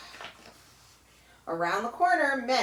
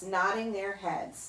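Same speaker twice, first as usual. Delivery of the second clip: plain reading voice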